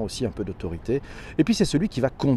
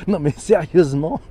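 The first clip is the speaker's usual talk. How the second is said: laughing through the words; 4 dB louder than usual. Real, not acted. Repeats the same phrase with a laugh.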